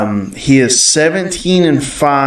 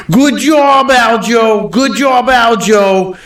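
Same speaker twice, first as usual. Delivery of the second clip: put-on New York accent; 9 semitones higher, slower than usual. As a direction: yell it like a New York cornerman. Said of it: "that was not a bad New York accent".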